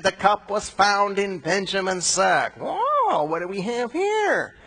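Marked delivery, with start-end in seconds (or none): 2.6-4.6 much higher, gleeful "whoa" of discovery; mock surprise. Servant finding the cup in Benjamin's sack, mock surprised. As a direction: catch them red-handed with a high whoa.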